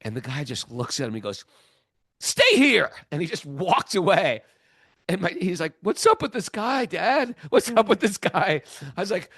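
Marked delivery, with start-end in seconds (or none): 2.2-2.9 loud, high barked order; sharp command. Retold or imitated. Devotee ordering the boy to stay, sudden loud command. 5.8-8.6 baffled son. Retold or imitated. Son confused, asking his dad.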